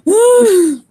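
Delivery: loud, high groaning sigh; exasperated sigh. Acted. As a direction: let out a big exasperated groan. Exasperated character after rubbing face.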